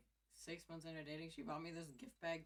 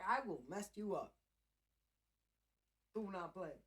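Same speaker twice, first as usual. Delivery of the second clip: tough cat voice; 4 semitones higher, 8 dB louder than usual. Pretend. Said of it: "Trixie's like".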